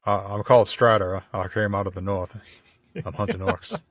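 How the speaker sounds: a light [name] croon as Aragorn; [name] as Strider. Pretend. Host answers as Aragorn, lightly voiced [name]. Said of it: "I will never do an [name] impression again".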